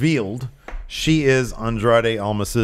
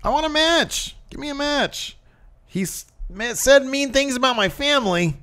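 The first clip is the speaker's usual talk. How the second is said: high-pitched mock tantrum; whiny wrestler. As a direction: complain in a high, childish mock voice. Mocking a wrestler's childish grievance.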